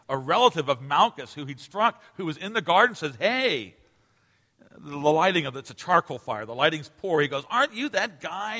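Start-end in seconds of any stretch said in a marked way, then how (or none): 3.2-3.8 mock accusing call, pitched up; menacing challenge. Malchus's relative confronting Peter by the fire, mildly menacing. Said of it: "more menacing". 7.5-8.6 high-pitched, retold accusing question; menacing challenge. Malchus's relative recognizing Peter in poor light, mildly menacing. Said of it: "more menacing".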